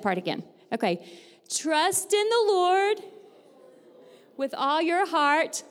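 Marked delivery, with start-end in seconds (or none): 1.5-5.6 high, slow classroom chant; teacher calling out lines to echo; call for repetition. Shown as a demonstration. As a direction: lead them phrase by phrase, drawn out. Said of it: "repeat after me".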